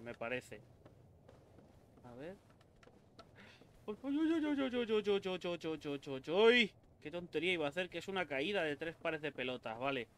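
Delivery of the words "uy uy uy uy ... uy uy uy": startled. Real, not acted. Near fall at edge.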